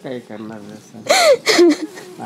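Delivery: weep through the words, voice cracking; tearful crying. Acted. Old woman weeps at insult. (रडत, हुंदके देत बोलणे)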